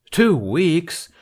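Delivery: high, loud disbelieving echo; shocked repeat of his words; incredulous. Acted. Bunny reacts to Jack's request.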